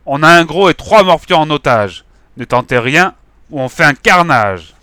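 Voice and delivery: loud, high threatening shout. Acted. Gang leader shouting at police, loud. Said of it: "Le bigleux a crié".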